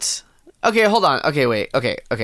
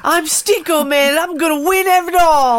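very high squeaky cartoon voice; shrill it up like an anime kid; high cute anime girl; 11 semitones higher, 3 dB louder than usual. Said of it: "Do your best anime voice".

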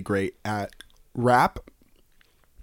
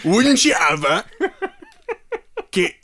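mock English-Australian accent; 6 semitones higher than usual. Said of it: "I'm vacillating between two different accents".

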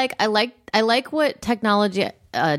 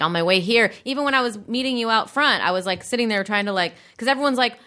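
ordinary talk

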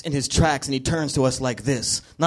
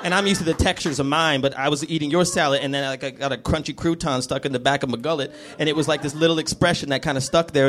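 usual speaking voice

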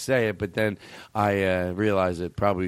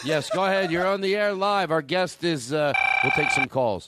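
higher, mock talk-radio host intro; hammed-up on-air announcer; 7 semitones higher than usual. Host introducing the guest like an old radio show.

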